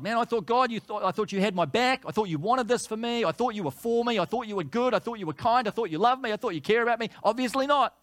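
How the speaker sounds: a wounded grumble flung upward at God; bitter complaint to God. Pretend. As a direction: pour out betrayed bitterness at God. Grumbling against God acted out.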